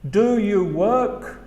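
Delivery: slow, rising "Do you work"; slow demo question; slow model question. Shown as a demonstration. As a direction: say it slowly with a rise. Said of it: "Por ejemplo".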